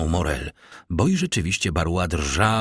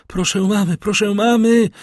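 much higher, rising to a louder cry; calling on the stairs; 13 semitones higher, 5 dB louder than usual. A narrator's reading. Valentine calls her stepmother from the stairs in alarm. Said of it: "wołała Walentyna".

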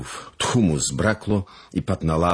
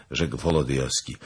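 plain reading voice

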